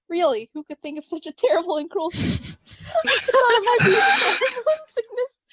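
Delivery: high-pitched laughing voice. Acted. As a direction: laugh through the words. Coran acts shocked, laughs.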